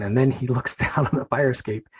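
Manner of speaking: usual speaking voice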